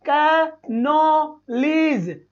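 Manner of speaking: slow wrong कनोलीज; mock wrong pronunciation. Shown as a demonstration. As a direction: stretch कनोलीज slowly as a wrong example. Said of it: "ऐसे बोलूंगा क्या?".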